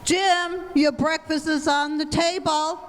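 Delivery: high-pitched call to the breakfast table. Acted. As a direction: call your husband in to eat. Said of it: "The average mother calls".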